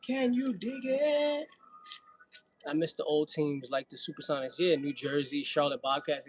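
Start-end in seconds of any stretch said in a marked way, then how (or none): 0.1-1.5 high, slow and stretched; slow stretched high catchphrase; playful drawl. Real, not acted.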